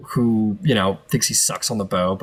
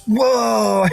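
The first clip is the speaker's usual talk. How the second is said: sudden high delighted gasp of awe; whoa; 11 semitones higher, 4 dB louder than usual. Acted. Tiefling NPC to the player, a high amazed whoa.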